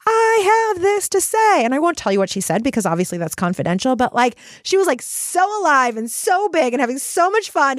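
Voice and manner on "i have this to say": high, drawn-out mock scream; slow, high-pitched cry of release, held back in volume; imitated scream. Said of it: "basically screaming".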